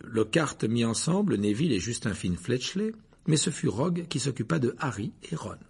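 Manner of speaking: ordinary talk, nothing marked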